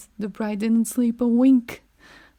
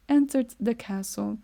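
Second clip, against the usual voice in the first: ordinary delivery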